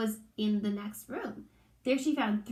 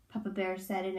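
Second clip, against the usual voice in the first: usual speaking voice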